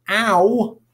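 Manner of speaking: "ow," said as the glide in vow; diphthong as in vow. Shown as a demonstration. Teacher models the diphthong, sounding it out. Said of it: "as in vow".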